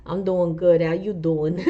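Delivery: put-on light New Jersey accent, laughing; a playful hint of New Jersey. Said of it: "in my Jersey voice".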